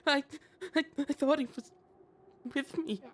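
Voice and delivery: high, stuttering sobs; sobbing. Acted. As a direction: choke the words out through sobs. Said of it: "Luca stuttered through heaving sobs".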